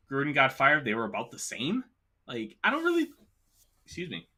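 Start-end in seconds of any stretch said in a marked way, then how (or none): 2.6-4.3 talking through hiccups. Real, not acted. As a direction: voice jumping up on a hiccup. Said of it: "You got the hiccups".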